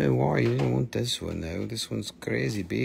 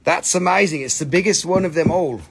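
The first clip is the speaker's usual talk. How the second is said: louder, higher burst of delight; really thrilled, say it big; excited exclamation; 5 semitones higher, 7 dB louder, faster than usual. Real, not acted. Repeats phrase, louder and higher.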